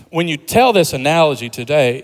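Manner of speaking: ordinary talk, nothing marked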